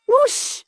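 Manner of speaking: imitated rushing blast; high-pitched whoosh. A narrator's reading. Narrator mimics the monster's water cannon, high whoosh.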